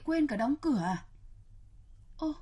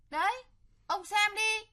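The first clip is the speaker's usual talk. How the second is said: higher, needling sarcasm; sarcastic jab; 6 semitones higher than usual. Acted. Stepmother needling her husband about praising the son, higher sarcastic tone. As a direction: jab at him mockingly.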